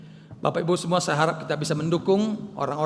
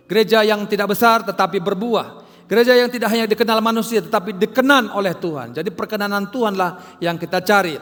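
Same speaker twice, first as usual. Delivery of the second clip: loud, driving sermon delivery, higher and louder; preaching at full force; 5 semitones higher, 7 dB louder than usual. Acted. Pastor preaching loudly to his congregation about bearing fruit. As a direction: hammer the message home to the church.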